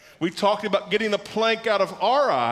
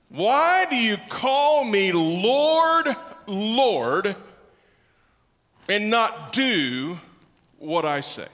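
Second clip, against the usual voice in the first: very slow, with "Lord" and "Lord" set apart; preacher's weighty, drawn-out "Lord, Lord"; slow, emphatic stress; much slower than usual. Shown as a demonstration. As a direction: stretch "Lord, Lord" and "do", pausing after each phrase. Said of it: "the emphasis on Lord".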